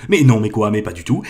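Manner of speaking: ordinary delivery, nothing marked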